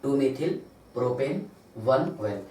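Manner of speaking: टू methyl propane one ओएल spelled out very slowly, word by word; slow dictation. Shown as a demonstration. Teacher repeats the answer slowly for students to write. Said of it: "क्या बताइए?".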